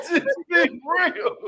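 high laughing squeal, then words slow through it; laughing hard. Real, not acted. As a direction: burst out laughing and barely get the words out. Cracking up at own joke.

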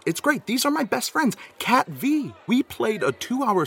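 high-pitched gushing over best friends; overexcited fan gush; gushing excitedly. Acted. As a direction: squeal about your best friends.

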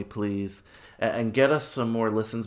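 normal talking voice

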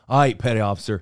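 mock sound-off. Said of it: "they thought I was saying".

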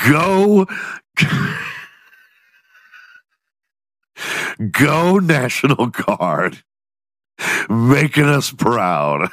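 mock proud, slow cheer; sarcastic rallying cry. Sarcastic cheer after news.

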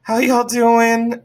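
high, stretched-out hello; mock-awkward morning-after pleasantry; awkward greeting. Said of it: "just so off".

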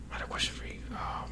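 whispered aside. Real, not acted. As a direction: whisper it under your breath. Whispered aside after plain take.

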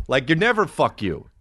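usual speaking voice

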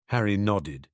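ordinary delivery, nothing marked

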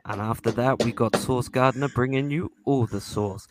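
low midnight DJ voice; put-on smooth, low radio patter; [name]. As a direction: croon it like a late-night jazz radio host. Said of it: "D J voice".

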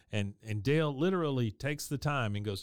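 ordinary talk, nothing marked

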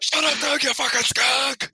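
shouting. Acted. Opening quoted outburst.

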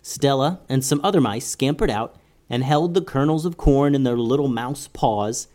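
ordinary delivery, nothing marked

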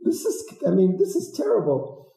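high, appalled disbelief at herself; a playful shocked gasp at an old photo; mock horror. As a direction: squeal it like you can't believe that was you. Horrified at old photo of self.

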